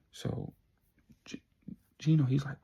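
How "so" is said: ordinary talk, nothing marked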